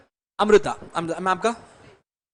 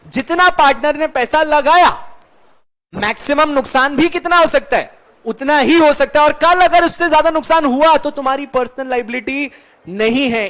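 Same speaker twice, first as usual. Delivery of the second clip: louder, higher, driving the point home; emphatic teaching; 5 semitones higher, 6 dB louder than usual. Real, not acted. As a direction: hammer the main point to the class. Teacher stresses key point. (ज़ोर देकर, ऊँची आवाज़ में समझाते हुए)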